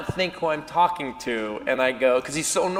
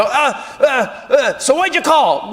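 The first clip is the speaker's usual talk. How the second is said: flustered panic; 9 semitones higher, 4 dB louder, slower than usual. Retold or imitated. Panicking on the phone.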